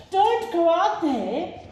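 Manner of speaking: family warning Small, frightened; frightened gasp. A narrator's reading. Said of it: "gasped her family".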